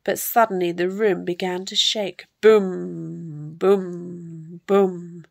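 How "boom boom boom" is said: long, resonant low booms; drawn-out storybook boom; booming footsteps. A narrator's reading. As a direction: imitate heavy thuds, letting each ring. Giant's footsteps shake the room.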